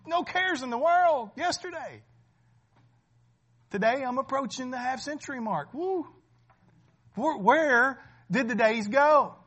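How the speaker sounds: loud, driving sermon voice, pressing each point home; preaching at full force. Acted. Pastor warning the congregation their days are few, preaching loud. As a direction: preach it hard to the pews.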